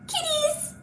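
high squeaky reveal of kitties; excited squeal. Acted. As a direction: squeal it like a delighted kids' teacher.

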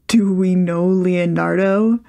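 slow, deadpan sarcastic question; mock innocent dry tone. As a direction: ask as if it's obvious. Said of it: "Botan asks dryly".

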